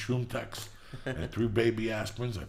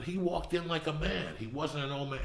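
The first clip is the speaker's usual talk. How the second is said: ordinary talk, nothing marked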